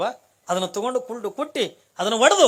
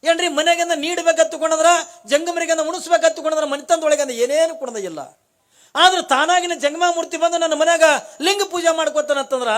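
higher, louder sermon voice, pressing each phrase; impassioned preaching; 9 semitones higher, 6 dB louder than usual. Acted. Storyteller to devotees on the saint's arrival, impassioned preaching. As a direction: preach it out to the hall with fervour.

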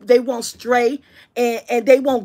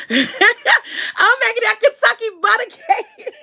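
laughing; 8 semitones higher than usual. Real, not acted. Laughing, distracted by cake.

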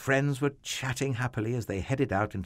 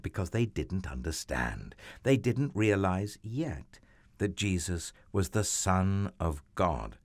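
ordinary delivery

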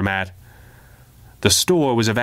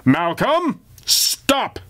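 high, sharp command to stop; high commanding call; commanding cry; 10 semitones higher, much slower than usual. Acted. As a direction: order the zombie to halt. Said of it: "cry in a commanding tone".

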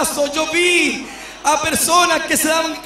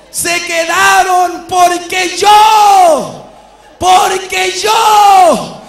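very slow, much louder, higher proclamation; voice of the Lord; 4 semitones higher, 7 dB louder, much slower than usual. Retold or imitated. The Lord tells the preacher why they stayed, very slow booming proclamation. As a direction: drag out each porque yo like thunder from heaven. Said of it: "Y él me dijo".

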